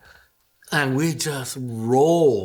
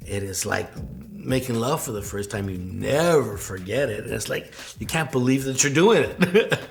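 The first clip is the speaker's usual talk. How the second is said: ordinary talk, nothing marked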